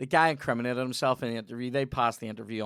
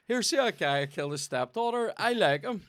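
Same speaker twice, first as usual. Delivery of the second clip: mocking voice; 6 semitones higher than usual. Mocking the odd fan.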